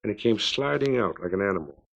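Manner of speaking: ordinary delivery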